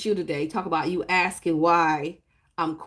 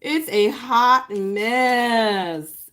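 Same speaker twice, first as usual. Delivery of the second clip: drawn-out savor; 3 semitones higher, 4 dB louder, much slower than usual. Real, not acted. Savoring her own phrase.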